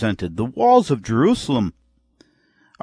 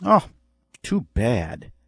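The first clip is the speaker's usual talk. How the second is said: indifferent sympathy; slower than usual. Acted. Preacher mimics an uncaring response to bad news, mild indifference. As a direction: give a mild, throwaway show of sympathy. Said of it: "the response was not this".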